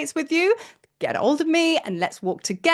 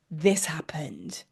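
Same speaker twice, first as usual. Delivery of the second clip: slow, breathy whisper for the reveal; dramatic whisper; 8 semitones lower, 8 dB quieter, much slower than usual. Acted. Teller to viewers, revealing the photo in a slow whisper. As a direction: whisper it slowly like a secret.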